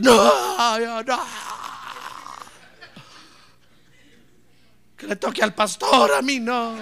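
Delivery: hammed-up wailing refusal, loud first NO; mock protest wail. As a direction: pretend to wail in protest for laughs. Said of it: "¿Acaso va a decir". (lamento fingido y exagerado de protesta)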